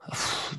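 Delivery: soft, breath-only exhale; weary sigh. Real, not acted. Musician thinking of dealing with band egos, weary sigh. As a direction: let out a heavy puff of air.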